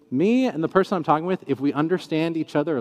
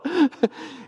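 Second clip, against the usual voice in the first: 1 laugh; 12 semitones higher than usual. Real, not acted. Reacting to agnostic seatmate.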